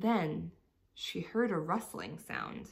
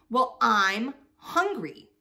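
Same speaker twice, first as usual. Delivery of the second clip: higher, much louder, slow snap; snapped; 5 semitones higher, 11 dB louder, slower than usual. A narrator's reading. Trixie the rat snaps at the mouse, angry. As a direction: bite the words off hungrily. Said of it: "Trixie snapped".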